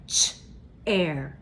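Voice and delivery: "ch, air" split into onset and rime; segmenting with student. Shown as a demonstration. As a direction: say "ch" then pause then "air". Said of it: "can you do that with me".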